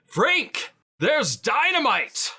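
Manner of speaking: high, loud holler for someone by name; hammed-up high yell; calling out. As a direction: shout across a battlefield to get his attention. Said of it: "we'll call out him specifically and say".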